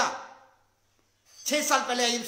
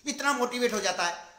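normal talking voice

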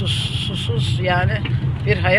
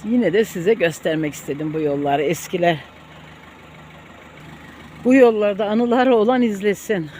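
usual speaking voice

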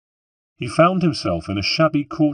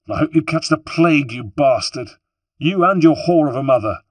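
much higher and louder, spitting curses; furious cursing; 8 semitones higher, 7 dB louder than usual. Acted. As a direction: hurl every insult at him. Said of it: "she flared at him".

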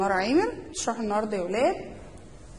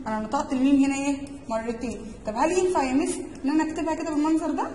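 ordinary talk, nothing marked

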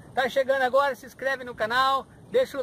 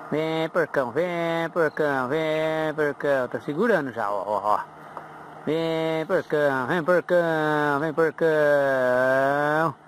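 low, slow coaxing call to the fish; 6 semitones lower than usual. Real, not acted. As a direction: lure them in with a low sing-song.

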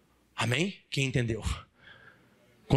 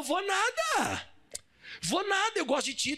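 high, drawn-out wheedle; wheedling scorpion; 13 semitones higher, slower than usual. Acted. Scorpion talking the turtle into a ride, wheedling. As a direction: coax in a high, stretched-out voice.

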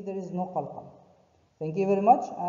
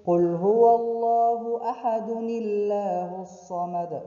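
recited continuing; 3 semitones higher, 5 dB louder than usual. Shown as a demonstration. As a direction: run the verse on without stopping. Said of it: "So if we'll continue".

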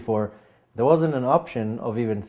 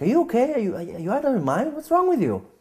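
much higher, shocked and baffled; incredulous friend; 11 semitones higher than usual. Retold or imitated. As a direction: friend thinks he's lost his mind. Friend shocked at early call.

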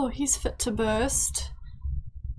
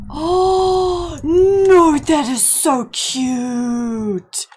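loud, high gushing wail, drawn out; cute-overload squeal; 6 semitones higher, 10 dB louder, much slower than usual. Real, not acted. Player melting at her little boy running around, gushing squeal. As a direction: squeal and stretch it out, melting at the cuteness.

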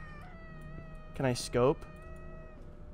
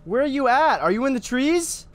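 calling out; 10 semitones higher than usual. Real, not acted. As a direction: shout for the hidden thing. Calling out for hidden mimic.

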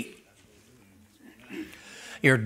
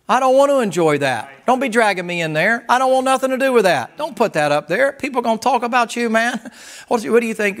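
protesting inner voice; 9 semitones higher, 5 dB louder than usual. Retold or imitated. New guy voice protesting, higher.

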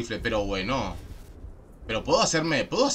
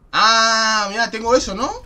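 excited; 9 semitones higher, 8 dB louder than usual. Real, not acted. Excited discovery in game.

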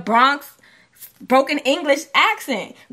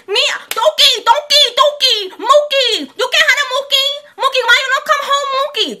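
much higher and louder, like a little mouse; Rosie Perez voice; 8 dB louder than usual. Retold or imitated. Co-host mimics an actress to win the argument, high little mouse voice. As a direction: pitch way up into a tiny mouse voice, laughing through it. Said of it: "Her voice is".

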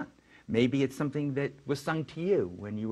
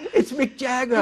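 laughing; 13 semitones higher, 7 dB louder than usual. Real, not acted. Laughing at star encounter.

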